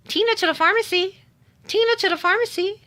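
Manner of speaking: page the customer over the store speaker; pharmacy announcement. Retold or imitated. Pharmacy calling her name over speaker.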